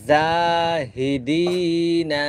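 long drawn-out madd on জাহিদিনা; elongated vowel. Shown as a demonstration. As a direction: stretch the vowel one alif long. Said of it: "এক আলিফ লম্বা করে পড়ব". (টানা দীর্ঘ উচ্চারণ)

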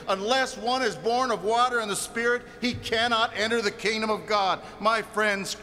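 ordinary delivery

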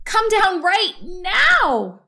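big brother loses patience, shouting; angry order. Acted. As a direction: lose patience and shout her down. Fox orders sister down again.

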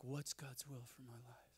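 hushed, breathy whisper of a seeker; mock whisper asking God's will; whispered question. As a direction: whisper it like a private worry. People asking about God's will.

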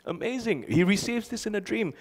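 high, breathy-laughing awe; amazed. Real, not acted. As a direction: marvel at it with a laugh. Preacher marvels at story.

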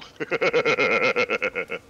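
long, loud rattling laugh; bursting laugh. Real, not acted. Player admitting the boast is untrue, long loud laugh. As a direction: laugh it out in rapid pulses.

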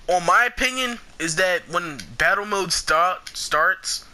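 a spontaneous, unplanned British accent; sudden British accent. Real, not acted. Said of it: "why I just had that British accent".

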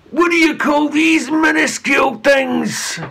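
loud, high scornful shout. A narrator's reading. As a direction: sneer at the tiny bread. Angry chief mocks sandwiches.

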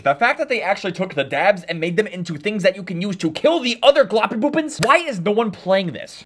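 ordinary talk, nothing marked

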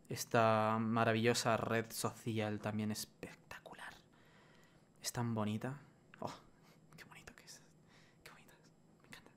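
susurrado, mucho más suave y lento; susurro sarcástico. Real, not acted. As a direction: elogio irónico, casi en silencio. Elogio sarcástico a Instagram. (susurra con sarcasmo lo bonita que es Instagram)

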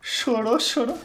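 high rising teasing chant; playful mock "chorou"; sing-song taunt. Real, not acted. Taunting opponent.

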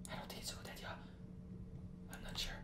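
hushed doubtful whisper. Real, not acted. Streamer doubts his own move, whispering to himself. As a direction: breathe it out under your breath. Doubtful aside while going in.

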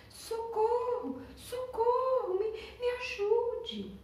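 high, slow little cry for help from afar; a small creature calling faintly far away; distant cry for help. Acted. Sloth calls for help. (grito de socorro agudo e lento, de longe)

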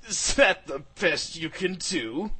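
slow, taunting mock of a villain. A narrator's reading. Demon villain taunting the hero after his strike, mocking. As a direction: sneer at a feeble attack, drawn out. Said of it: "in a mocking voice".

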